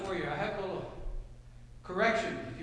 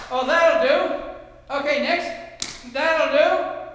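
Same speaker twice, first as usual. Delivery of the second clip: slow, loud, offhand voice; dismissive cookie-cutter shrug; 6 semitones higher, 10 dB louder, much slower than usual. Pretend. Preacher mocking a careless cookie-cutter God, slow and dismissive. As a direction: brush them off with a slow shrug.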